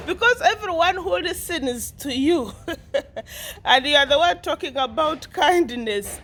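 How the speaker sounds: high talk broken by laughter; laughing. Real, not acted. Vlogger laughing at Carol's preaching. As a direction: keep laughing as you talk.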